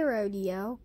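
ordinary talk, nothing marked

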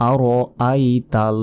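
আরআইতাল said very slowly, every syllable drawn out; slow, precise recitation of আরআইতাল; correct Quranic pronunciation. Shown as a demonstration. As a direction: sound out আরআইতাল like a careful teacher. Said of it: "আরাইতাল নয়".

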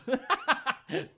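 high, loud laugh; self-amused laughter. Real, not acted. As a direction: crack up at your own bad impression. Laughing at own bad impression.

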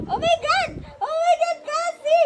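high, astonished exclamation; exclaiming. Real, not acted. Baby swallows food straight away, astonished exclaiming.

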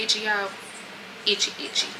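"itchy" pressed hard, last pair breathed unvoiced; stressed itchy, trailing to whisper. Real, not acted. Telling viewers about her itchy scar, emphatic then whispered. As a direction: lean on every "itchy", then drop to a whisper. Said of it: "When I say itchy".

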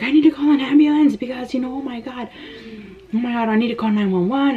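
high-pitched mock panic on the phone; retold freak-out. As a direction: act out a panicky plea for help. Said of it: "I was freaking out".

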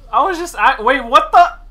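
high, loud, startled outburst; real high-pitched alarm; shocked protest. Real, not acted. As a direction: cry out in disbelief mid-sentence. Reacting to being attacked in game.

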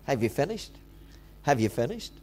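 low, soft, patient question; quiet, indulgent fatherly voice; smiling patience. Acted. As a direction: ask gently through a smile. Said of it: "I think he just smiles".

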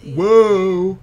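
startled by something on screen; surprised whoa. Real, not acted. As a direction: blurt it out, caught off guard. Sudden game surprise.

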